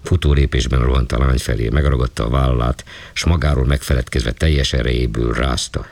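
usual speaking voice

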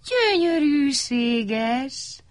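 very slow, high swoon; dreamy chorus of wonder; awed admiration. A narrator's reading. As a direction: stretch it out in high-pitched rapture. All admire the cloud curler.

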